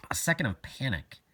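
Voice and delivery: plain reading voice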